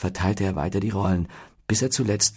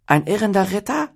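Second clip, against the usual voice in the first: high dopey voice, guessing; a simpleton's hopeful question; dim-witted; 12 semitones higher, 5 dB louder than usual. A narrator's reading. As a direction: squeak it out, clueless. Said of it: "fragte Flaut dümmlich".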